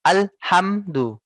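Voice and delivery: Alhamdu with the hm cut short and jerked; choppy alhamdu. Shown as a demonstration. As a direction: brake hard on the sukun in Alhamdu. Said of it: "Seperti ini contohnya". (Alhamdu dibaca direm, terputus)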